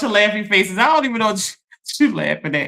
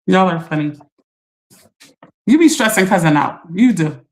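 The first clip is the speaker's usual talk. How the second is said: ordinary talk, nothing marked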